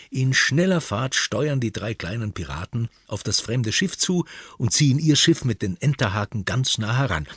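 plain reading voice

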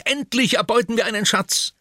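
hoch und laut, voller Vorfreude; aufgeregt. Acted. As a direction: jubelt über die Beute. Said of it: "schreit Oliver aufgeregt". (Oliver freut sich auf den Schatz, aufgeregt laut)

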